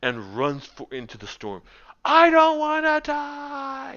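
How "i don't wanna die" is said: high, drawn-out, loud wail; terrified Norin. Acted. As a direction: wail it as you run into the storm. Norin flees into storm.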